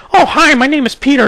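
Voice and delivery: very high, silly mock kid voice; squeaky, mocking the old pures; pure talking. Mocking how pures talked back then, high and silly. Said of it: "every fucking pure would talk like this".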